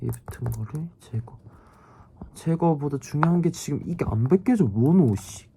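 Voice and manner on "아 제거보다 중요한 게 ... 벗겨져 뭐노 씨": annoyed ugh. Real, not acted. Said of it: "진짜 짜증난다".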